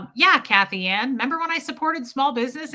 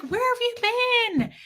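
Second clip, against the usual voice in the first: excited welcome; 12 semitones higher, faster than usual. Retold or imitated. Shop owner greeting, retold.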